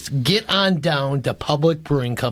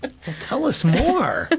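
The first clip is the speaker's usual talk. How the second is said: laughing tease; 10 semitones higher than usual. Real, not acted. Host teases the guest about her family, laughing. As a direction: tease her into telling more.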